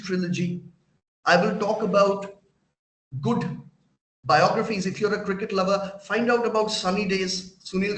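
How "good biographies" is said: ordinary talk, nothing marked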